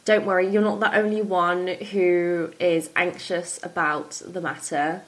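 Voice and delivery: ordinary talk, nothing marked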